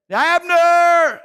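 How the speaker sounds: loud high call across a wide valley. Acted. David calling across to the sleeping army, loud shout. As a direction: shout the name to wake the camp.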